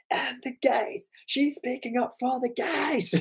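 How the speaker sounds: high, strained re-enacted protest; high-pitched mock insistence; insistent retelling. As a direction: pitch it right up, without much volume. Mock insistence in retold argument.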